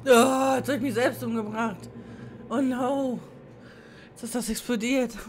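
loud high dismayed cries; panicking gamer. Real, not acted. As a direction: cry out as it all goes wrong. Gamer blew himself up.

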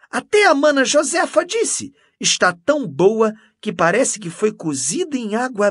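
loud, high, excited enthusiasm; gushing. A narrator's reading. Canon boasting of her gifts to Mendes, gushing. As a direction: gush over each treat, pitched well up.

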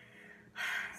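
long breathy exhale; emotional, steadying sigh; heavy sigh. Real, not acted. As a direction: sigh to collect yourself. Collecting herself after emotional moment.

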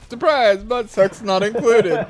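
loud surprise yell; shout. Acted. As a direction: burst out with a joke shout. Shouted game taunt.

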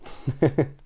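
short mischievous giggle; gleeful chuckle. Acted. Mister Tickle relishes the plan, giggling. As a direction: snicker with delight.

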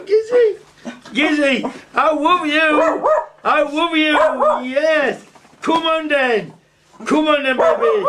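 very high, slow, tender cooing; doting dog talk. Real, not acted. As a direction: gush over your best friend, slow and high. Cooing love to his dog.

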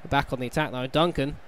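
ordinary talk

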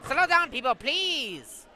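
high, loud plea to the crowd; loud call for calm. Acted. Judge shouting at the unruly crowd, loud and high. Judge calming a rowdy court.

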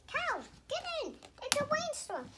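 high, loud warning cry; alarmed shout. Pretend. Child voicing the goat warning cows of the rainstorm, high shout. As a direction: call the animals in urgently.

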